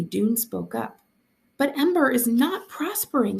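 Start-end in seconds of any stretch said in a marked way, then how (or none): 1.6-3.4 raised, high protest, pleading; cried out. A narrator's reading. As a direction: cry out against the mayor. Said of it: "he cried".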